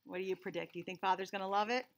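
ordinary delivery, nothing marked

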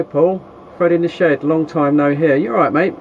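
ordinary talk, nothing marked

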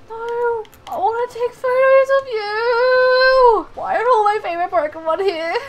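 high, loud, half-sung wail; hammed-up crying complaint; mock wail. Wailing as favourites pass by.

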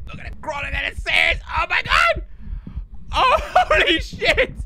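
loud high excited yelling; excited shout. Real, not acted. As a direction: shout it, amazed and thrilled. Sees ghost crawl, shouting.

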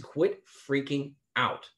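slow, drawn-out calming order; slow it right down to settle him; calm command. Retold or imitated. Preacher retells telling his friend, slow and calm. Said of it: "I said".

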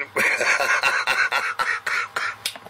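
long, trailing chuckle; real laugh. Real, not acted. Radio operator amused after retelling a refusal, real laugh. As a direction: laugh it out at some length.

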